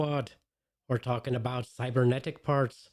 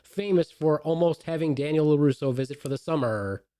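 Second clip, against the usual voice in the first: louder ring-announcer build-up; announcer intro; 4 dB louder than usual. Acted. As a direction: hype the guest like a boxing announcer. Announcer-style guest introduction.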